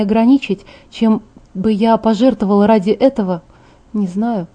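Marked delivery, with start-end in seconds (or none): none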